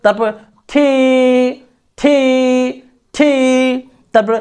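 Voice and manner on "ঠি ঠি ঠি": "ঠি" with puffed T and long held i; drawn-out letter T. Shown as a demonstration. Teacher showing alphabet letters, drawn-out aspirated ঠি. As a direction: hold the vowel of "ঠি". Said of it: "একটু টান দিবেন".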